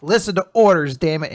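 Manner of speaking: mock yell. Ichika yelling at Nico.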